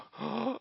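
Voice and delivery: sharp high gasp; imitated gasp. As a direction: suck in air, scandalized. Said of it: "The lady leading the program gasped".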